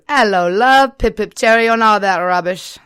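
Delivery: loud, high, hammed-up British accent. Shown as a demonstration. Showing off his best accent, exaggerated British accent. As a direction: belt out a cartoonish British greeting. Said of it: "What is your best accent?".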